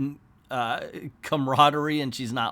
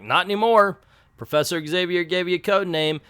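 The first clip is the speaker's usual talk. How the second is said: raised, louder retort, acted lightly; firm overruling; 4 semitones higher, 5 dB louder than usual. Cyclops overrules Thunderbird about his code name, mock-firm. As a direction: play a leader cutting off an objection. Team leader overruling a complaint.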